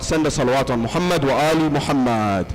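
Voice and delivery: ordinary delivery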